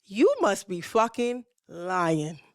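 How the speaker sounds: slow, drawn-out disbelief; stunned disbelief. Real, not acted. Woman hears ex was never divorced, slow stunned disbelief. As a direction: let the shock slow every word. Shocked at his confession.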